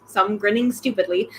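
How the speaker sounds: plain reading voice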